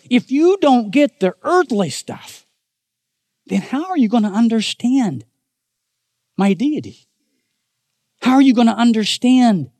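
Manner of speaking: high, driving preacher's rebuke, pressing each question; Jesus rebuking. Acted. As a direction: build the questions like hammer blows.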